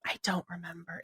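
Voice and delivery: hushed, breathy aside to herself; whisper. Real, not acted. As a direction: drop to a near whisper as you try to recall. Pausing to recall, drops voice.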